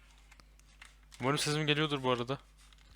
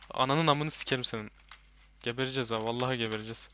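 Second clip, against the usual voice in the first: ordinary delivery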